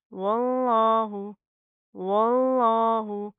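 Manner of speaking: loud, slow chanted recitation; heavy Allah. Shown as a demonstration. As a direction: fill the mouth on the lam of वल्लाहु. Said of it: "लफ्ज़ अल्लाह को मोटा पढ़ेंगे".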